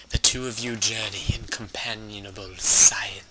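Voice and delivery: dramatic fantasy narrator voice; story narration. Acted. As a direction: read like an epic tale.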